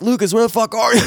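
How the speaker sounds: high, strained call breaking into coughs; mock choking on tear gas mid-call; imitated coughing fit. On the phone to Lucas while tear-gassed, mock coughing. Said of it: "I'm just, like, uncontrollably coughing".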